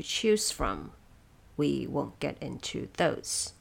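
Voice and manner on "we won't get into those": normal talking voice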